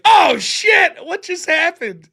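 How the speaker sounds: high, loud startled yell; mock panicked shout. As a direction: shout it like a player caught off guard. Imagined players ambushed by monsters.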